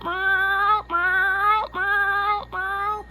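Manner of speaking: high mock meows; cat meowing. Vlogger imitating the video cat Felix, high meows. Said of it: "Felix goes".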